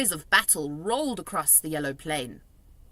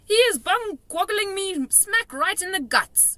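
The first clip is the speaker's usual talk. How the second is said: loud, high outraged shout; 11 semitones higher, 5 dB louder than usual. A narrator's reading. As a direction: shout in pain and anger. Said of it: "shouted the gizzard gulper".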